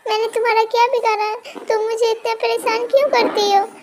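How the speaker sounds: high, fast, panicked plea; high pleading cry; frightened plea. Acted. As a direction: beg the ghost in fear. Rama pleads with witch.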